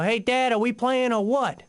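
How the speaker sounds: mild mock yell; retold yell. Kid calling to hiding dad, mild mock yell. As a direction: call out impatiently across the street. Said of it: "stand up and yell".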